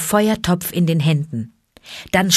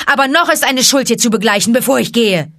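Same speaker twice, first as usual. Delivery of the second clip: put-on raised, higher boy's voice, defiant and proud; a boy standing up to the wolves; defiant Mowgli; 6 semitones higher, 5 dB louder, faster than usual. A narrator's reading. Mowgli defying the wolf pack, raised and proud. Mowgli confronts Shere Khan.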